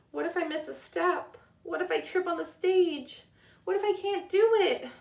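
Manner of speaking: put-on a nervous girl talking herself into a fright; anxious worry. A narrator's reading. A girl backstage before her first show, rising panic. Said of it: "the little girl thought to herself".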